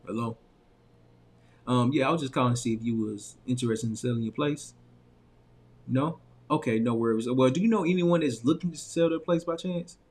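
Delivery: a grown-up professional on the line. Shown as a demonstration. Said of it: "notice the difference between the voices".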